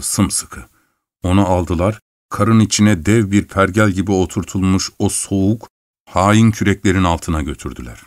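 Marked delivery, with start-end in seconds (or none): none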